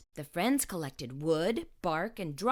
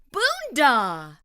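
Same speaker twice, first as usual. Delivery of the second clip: louder pop of surprise; sudden exclamation; 5 semitones higher, 7 dB louder than usual. A narrator's reading. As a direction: burst out as the fire catches. Sudden burst of fire.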